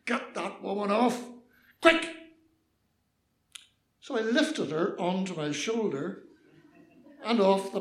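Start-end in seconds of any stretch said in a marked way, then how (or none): 0.1-2.1 terse, mildly raised police command; shouted order. Acted. Policeman on the bus platform ordering her off, shouting. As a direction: order it sharply, a contained shout. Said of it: "standing on the rear platform of the bus shouted".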